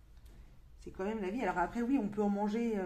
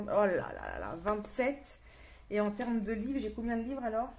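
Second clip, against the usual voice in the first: ordinary delivery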